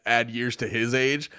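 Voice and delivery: normal talking voice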